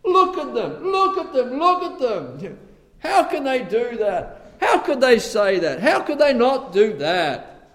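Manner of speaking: high, loud finger-pointing; hammed-up scandalised pointing; mock judgmental gossip. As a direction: mimic the self-righteous accuser. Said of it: "see so clearly the sin in other people's lives".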